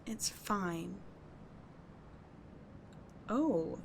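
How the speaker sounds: ordinary talk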